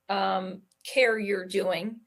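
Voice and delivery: normal talking voice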